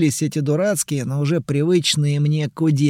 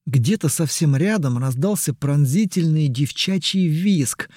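usual speaking voice

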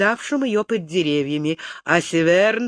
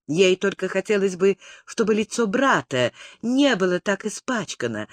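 ordinary delivery

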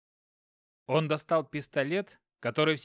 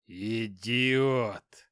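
growled; 3 semitones lower than usual. Acted. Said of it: "прорычал Хопкин".

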